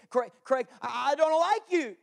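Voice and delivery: a hammed-up complaint; mock objector. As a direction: protest like a fed-up churchgoer. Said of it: "This is how Jesus gives".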